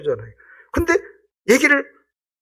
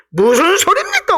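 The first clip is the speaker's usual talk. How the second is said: high, louder butting-back protest; indignant retort; 12 semitones higher, 4 dB louder than usual. Acted. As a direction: push back hard, offended. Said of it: "하고 들이받아요". (베드로가 예수님께 들이받듯)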